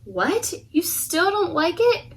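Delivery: ordinary delivery, nothing marked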